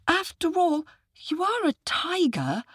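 put-on very high child voice; squeaky, unsure question; doubtful little boy. A narrator's reading. Boy questions the tiger's promise, high and doubtful.

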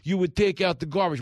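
high, loud, unflattering spouse voice; mocking spouse. Spouse mimicked in a fight, high and loud whine. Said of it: "you're like".

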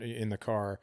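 ordinary talk, nothing marked

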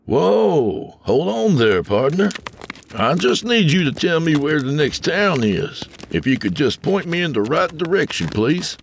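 high, eager stranger; pleading cowboy. A narrator's reading. Tony begs the driver for directions, eager. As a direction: talk the driver into helping.

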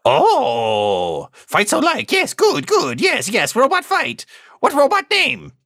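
squeaky little doorman demanding the password. Acted. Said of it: "a little voice says".

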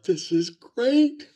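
high, drawn-out laughing delight; slow giddy glee. Retold or imitated. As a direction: savour every word with glee. Loves the fights as a rookie.

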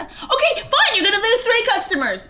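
loud, very high mock tantrum; throwing a fit. Wife imitating husband's threat to the restaurant, mock outrage. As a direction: imitate his outburst, shrieky and hammed-up. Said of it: "You threw a fit".

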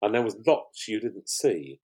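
normal talking voice

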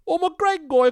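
put-on I'm a Greg guy in a loud, very high Liverpool accent. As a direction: shout I'm a Greg guy like a Scouse caller. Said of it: "this accent keeps coming back".

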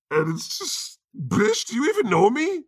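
high, sassy offended God voice; indignant God. Acted. A comedian voicing God, offended at being misunderstood, in a high sassy voice. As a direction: squeal it like a miffed deity.

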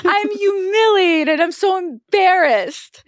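high, drawn-out, loud wail; mock tearful humiliation. Passenger retelling her shame on the plane, mock crying. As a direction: wail it like a hammed-up crying fit. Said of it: "I'm sitting there and, like".

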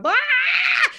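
high, loud mock-anguished yell; drawn-out high wail of exasperation; frustrated scream. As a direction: scream it out like a writer losing a year's work. Acts out a writer's frustrated outburst.

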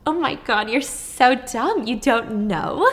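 drawn-out, mocking disbelief; mocking siblings. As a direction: tease her like a scornful sibling, slowly. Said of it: "her siblings laughed".

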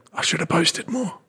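imitating a regretful creator; mock regretful voice. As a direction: whisper it like a haunting regret. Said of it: "You know".

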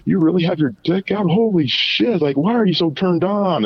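high mock shocked girl; imitating the shocked girl; girl's voice. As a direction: squeal her disbelief up high. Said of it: "then she's like".

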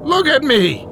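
piercing high scream; inhuman shriek. A narrator's reading. Louise grabs his ankle, shrieking inhumanly. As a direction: shriek it like something not human. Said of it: "Louise cried in an inhuman shriek".

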